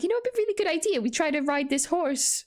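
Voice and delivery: high-pitched retold kids' voice; kids hatching a plan. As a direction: lightly act out children pitching a bright idea. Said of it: "we were like".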